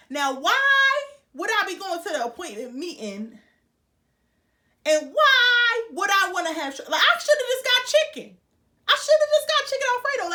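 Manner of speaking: high, loud comic regret; loudly kicking yourself, voice climbing; exasperated outburst. Real, not acted. Exasperated at own choice of food.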